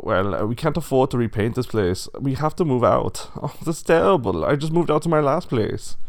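dismayed pig. A narrator's reading. Pigs lamenting to each other about moving out, dismayed. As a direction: voice an upset pig bemoaning the move.

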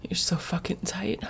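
ordinary delivery

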